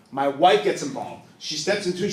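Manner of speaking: ordinary talk, nothing marked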